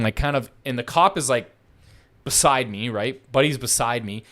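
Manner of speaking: normal talking voice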